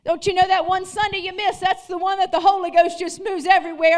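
loud, rising sermon cadence; fired-up pulpit preaching; preaching at full force. Acted. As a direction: preach it to the whole room.

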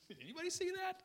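high-pitched comic astonishment; squeaky mock shock; freaked-out disbelief. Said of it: "I'm freaking out over that".

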